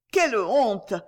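usual speaking voice

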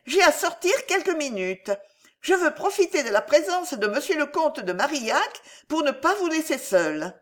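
put-on high-pitched old woman's announcement; old aunt aloud. A narrator's reading. Old Laura announces her outing to her niece, speaking aloud. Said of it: "la vieille le disait à haute voix, le criait presque".

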